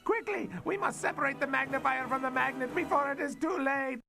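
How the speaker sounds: rising pitch, fast mounting alarm; panicking villain. Acted. Scientist seeing the tinfoil ball coming, rising panic. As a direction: go from dry to frantic.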